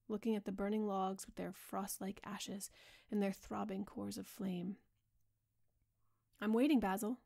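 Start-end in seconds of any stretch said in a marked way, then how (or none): 6.4-7.2 cold, hard and louder; hard, clear. A narrator's reading. Dorian pressing the painter, hard cold voice. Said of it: "in a hard, clear voice".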